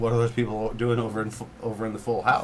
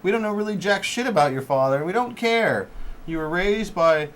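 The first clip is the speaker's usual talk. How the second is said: ranting; 7 semitones higher, 6 dB louder than usual. Acted. Ranting at the movie.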